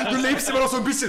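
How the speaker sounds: high, fast, laughing through words. Real, not acted. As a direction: giggle while talking. GM laughing at dying player.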